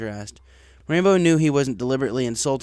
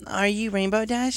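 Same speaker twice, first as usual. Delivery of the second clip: put-on higher, friendly young mare voice; cheerful courier asking; young mail mare; 9 semitones higher than usual. A narrator's reading. Gray mail pegasus asks.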